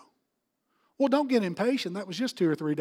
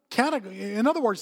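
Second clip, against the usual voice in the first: usual speaking voice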